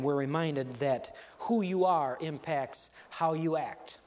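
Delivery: ordinary delivery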